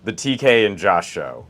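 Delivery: booming announcer welcome; radio announcer voice. Pretend. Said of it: "Your radio voice always kicks in".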